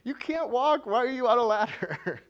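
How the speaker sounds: high, loud mock disbelief; incredulous onlookers. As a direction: play the shocked bystanders calling up the ladder. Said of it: "people are like".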